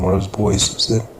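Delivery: ordinary delivery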